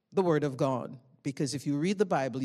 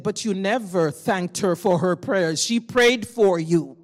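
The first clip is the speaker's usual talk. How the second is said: loud, emphatic retold scolding; louder, reproachful, drawn out at the end; 2 semitones higher, 8 dB louder than usual. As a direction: deliver God's rebuke forcefully. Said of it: "God scolded me".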